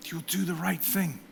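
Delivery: hushed second "You'll do the right thing"; soft repeat. Real, not acted. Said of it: "repeated softly after".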